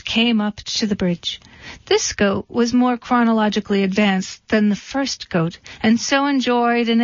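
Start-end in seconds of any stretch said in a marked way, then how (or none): none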